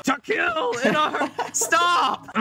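high laughing yell. Real, not acted. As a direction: shout stop while laughing. Laughing outburst.

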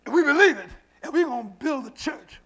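loud and measured, punching key words; emphatic declaration. Retold or imitated. Trustee backs his pastor before the lender, emphatic. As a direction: plant each phrase firmly. Trustee's stand, preached with force.

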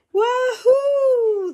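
high, loud whoop of delight; loud, high cheer of thrill; joyful yell. Acted. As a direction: whoop like a kid flying downhill on a sled. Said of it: "they yell".

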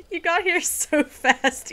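startled, loud and high. Real, not acted. To someone who showed up suddenly, startled. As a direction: blurt it out, drawn out, after a scare. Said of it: "You scared me".

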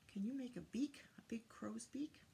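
plain reading voice

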